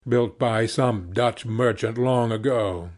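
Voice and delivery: ordinary delivery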